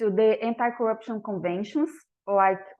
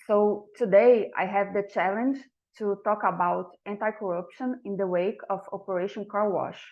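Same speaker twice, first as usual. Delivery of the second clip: usual speaking voice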